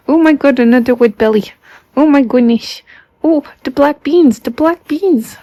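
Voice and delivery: high, loud delighted gush over the cat; loud high excited pet talk; excited squeal. Real, not acted. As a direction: squeal over the pet's belly. Excited over cat's wet belly.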